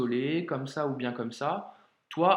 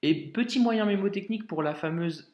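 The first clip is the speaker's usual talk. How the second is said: ordinary talk, nothing marked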